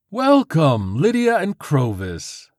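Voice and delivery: loud, high, slow hostess greeting; warm welcome. Acted. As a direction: welcome them grandly. Host greeting guests warmly.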